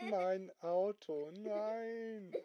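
hammed-up wail rising on the last word; acted dismay. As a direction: lament the car in drawn-out dismay. Owner finds his car shrunk.

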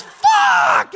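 very high, loud yell; a boyfriend shocked at his own bloody eye; shocked curse. Retold or imitated. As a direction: explode with a single swear.

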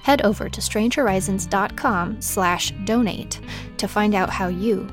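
plain reading voice

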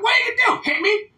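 high mocking taunt; mocking dare. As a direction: toss it off as a sneering high-pitched challenge. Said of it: "They're like".

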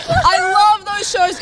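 high, slow, drawn-out enthusiasm; excited gushing. Real, not acted. Host on Chinese dating shows she loves, excited gushing. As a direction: gush it, stretching the words up high.

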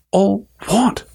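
lightly voiced boy asking, puzzled; puzzled question. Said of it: "asked Harry".

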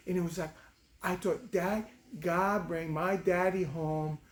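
imitated small daughter sharing a dream; five-year-old girl. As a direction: tell it like an earnest little kid. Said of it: "this little five-year-old girl telling me".

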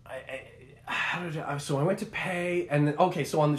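usual speaking voice

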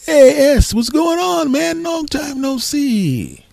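very high, stretched-out hail; excited greeting. Retold or imitated. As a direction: imitate the boss thrilled, drawing out the last words. Boss greets a favourite customer.

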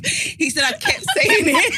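high, loud words through helpless laughter; laughing hard. Real, not acted. Wife retelling her catchphrase to friends, laughing hard. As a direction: drag it out while cracking up.

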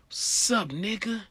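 a hint of a tough guy; menacing greeting. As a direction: greet him like a threat. Gangbanger greeting.

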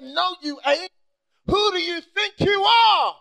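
loud slow rebuke; God rebuking. Acted. Preacher voicing God, loud slow rebuke. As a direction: bellow it back as God. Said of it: "And God said".

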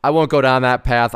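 normal talking voice

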